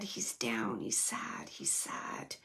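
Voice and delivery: hushed, breathless whisper of sadness; soft whispered lament. Real, not acted. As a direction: drop to a bare whisper, as if sharing a secret grief. Medium relaying spirit's sadness.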